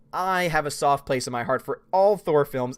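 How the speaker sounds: normal talking voice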